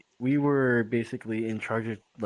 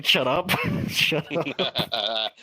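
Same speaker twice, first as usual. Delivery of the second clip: half-laughing, louder shut up; laughing protest; 3 semitones higher, 3 dB louder, much slower than usual. Real, not acted. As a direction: laugh it off while telling them to stop. Friends teasing, he protests laughing.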